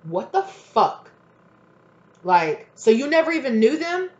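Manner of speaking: loud and high, a slow outraged what the fuck; confronting the liar in the car, loud and outraged; angry disbelief. Retold or imitated. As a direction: demand answers, furious. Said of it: "and this person is like".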